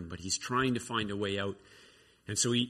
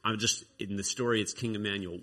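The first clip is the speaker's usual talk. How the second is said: normal talking voice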